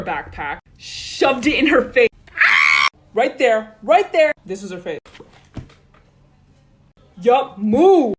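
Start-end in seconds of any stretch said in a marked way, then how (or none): 0.8-4.3 shouted climax. Acted. Climax of backpack fight. 7.2-8.1 loud command. Retold or imitated. Pushing past the bully.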